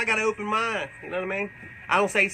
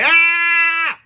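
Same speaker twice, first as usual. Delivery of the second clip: sudden high yell; a big literal shout-out yell; loud shout; 11 semitones higher, 10 dB louder than usual. Retold or imitated. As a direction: belt out a wordless shout. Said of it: "I just shout out loud".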